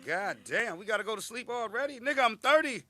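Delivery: high, loud comic protest; indignant outburst. Retold or imitated. Grown inmate protesting early bedtime, loud indignation. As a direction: complain in loud, high-pitched disbelief.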